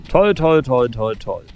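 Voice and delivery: sarcastic "toll" starting loud and dying away; mock enthusiasm trailing off into grumbling; sarcastic, fading. Real, not acted. Gamer annoyed that it rains again, sarcastic fading "toll".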